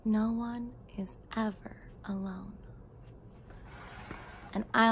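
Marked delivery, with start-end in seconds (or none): 0.0-2.5 softly breathed final line; a hushed storybook close; whispered ending. A narrator's reading. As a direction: whisper it like a secret. Closing line of the book.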